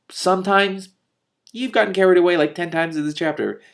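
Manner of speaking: high, teasing disbelief; incredulous aside. Real, not acted. Reader teasing Telemaine, high and incredulous. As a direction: scoff at the character. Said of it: "reader's own aside".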